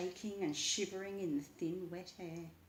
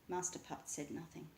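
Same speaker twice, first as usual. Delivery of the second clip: ordinary delivery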